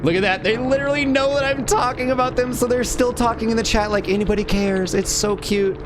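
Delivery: sarcastic baby-talk mockery; mocking cute voice. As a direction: coo at them like they're adorable toddlers. Mocking the chatters watching.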